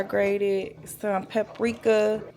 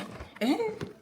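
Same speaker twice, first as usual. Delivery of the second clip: high giggle; 14 semitones higher, 6 dB quieter than usual. Real, not acted. Laughs at her own joke.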